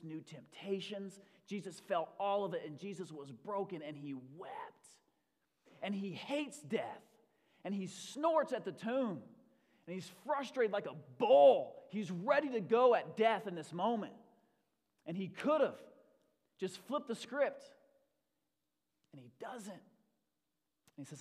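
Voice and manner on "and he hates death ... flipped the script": loud, driving sermon delivery; impassioned preaching. Real, not acted. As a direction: preach it with rising force. Preaches at full force.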